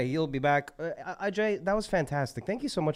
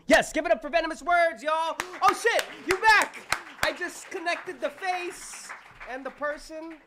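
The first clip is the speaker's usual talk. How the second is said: much higher, calling out to the crowd; excited hype. Real, not acted. Emcee welcoming the poet back, high and excited. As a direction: shout the welcome with delight.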